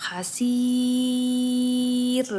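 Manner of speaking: "hasir" drawn out slowly with a light, thin r. Shown as a demonstration. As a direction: stretch "hasir", keep the r thin. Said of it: "bacanya tipis ya".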